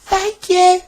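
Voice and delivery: relieved thanks in a very high voice; high little engine, relieved; Molly. A narrator's reading. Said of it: "she sighed".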